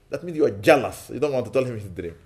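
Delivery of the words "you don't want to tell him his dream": usual speaking voice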